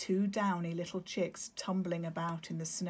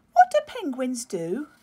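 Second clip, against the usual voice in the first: higher, loud, out-of-breath chick question; breathless; 7 semitones higher, 12 dB louder than usual. Acted. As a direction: ask it puffed from bouncing. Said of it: "he asked a little breathlessly".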